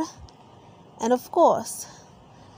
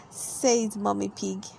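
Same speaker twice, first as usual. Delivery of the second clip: plain reading voice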